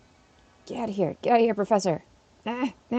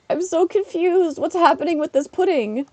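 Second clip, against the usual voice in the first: mock confusion at the chat, much higher; playful confusion; 9 semitones higher, 7 dB louder than usual. Real, not acted. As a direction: ham up being baffled.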